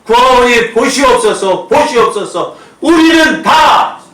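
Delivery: preacher shouting a prayer for the people; full-force preaching prayer. Acted. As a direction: pour it out to God at full volume. Pastor leads prayer at full force.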